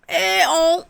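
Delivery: sarcastic high-pitched hi to the villain; mock greeting. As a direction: greet the ghost like an old friend, squealing. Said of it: "I said".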